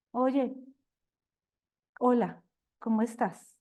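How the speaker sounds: unsmiling, held-back hello with pauses; demonstrating an aloof, self-sufficient hello; self-important greeting. Shown as a demonstration. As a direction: greet them coolly, as if above them. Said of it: "Yo estoy mostrando una cara distinta".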